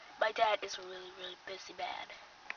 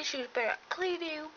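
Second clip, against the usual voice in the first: ordinary talk, nothing marked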